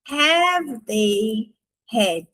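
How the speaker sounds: "Have they had?" stretched out very slowly; very slow model question; slow demo. Shown as a demonstration. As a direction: drag the question out for learners. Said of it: "a gente vai só inverter".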